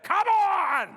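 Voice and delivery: loud, high outburst; angry swearing shout. Retold or imitated. Canadian unleashing forbidden words at a flight attendant, shouted. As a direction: explode on both words as if cursing. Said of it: "I unleashed the two words a Canadian should never say".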